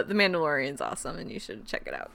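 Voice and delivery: quick fading mumble; rushed aside. Acted. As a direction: throw it away under the breath. Disclaimer aside, voice drops.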